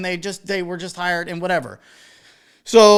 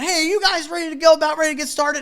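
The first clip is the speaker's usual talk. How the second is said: bright call to the group; 8 semitones higher than usual. Retold or imitated. DJ cheerfully calls to wedding party.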